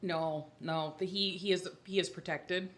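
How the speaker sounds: ordinary talk, nothing marked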